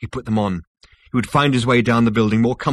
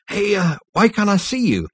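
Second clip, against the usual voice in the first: high-pitched, faltering then insistent; rattled, raised question; agitated demand; 11 semitones higher, 4 dB louder than usual. A narrator's reading. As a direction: demand it of someone invisible. Zaphod calls to unseen voice.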